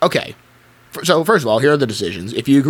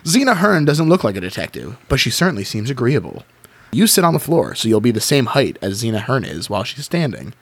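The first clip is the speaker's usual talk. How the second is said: ordinary talk, nothing marked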